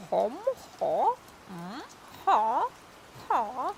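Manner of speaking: odd rising grunts from a madman; throaty, questioning gurgles, spaced apart; strange gurgle. Acted. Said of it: "A strange gurgle emerges from the man".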